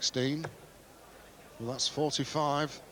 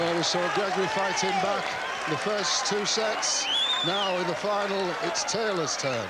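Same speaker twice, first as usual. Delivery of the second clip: higher, louder, fast commentary; excited commentary; 8 semitones higher, 4 dB louder than usual. Real, not acted. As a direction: push the pace up with rising excitement.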